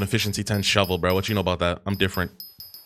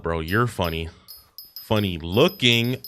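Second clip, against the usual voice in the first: ordinary talk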